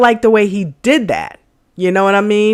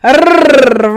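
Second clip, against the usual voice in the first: long, loud trilled R; rolled R; 4 semitones higher, 4 dB louder than usual. Shown as a demonstration. Proving to viewers you can do the R, rolled trill. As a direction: roll a long "Rrr" on the tongue. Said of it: "I can do the R".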